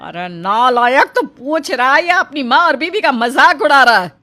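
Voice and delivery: गुस्से में डाँट. Acted. माँ बेटे को डाँट रही.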